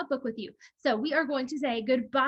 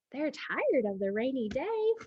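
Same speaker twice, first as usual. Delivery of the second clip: usual speaking voice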